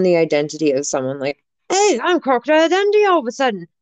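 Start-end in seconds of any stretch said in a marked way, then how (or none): 1.7-3.7 high, quick put-on Australian accent; Crocodile Dundee. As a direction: do a hammed-up Aussie accent. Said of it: "I don't know why everyone has this accent".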